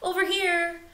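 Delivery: high little chick voice; chick calling. Acted. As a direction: call out to find your parent.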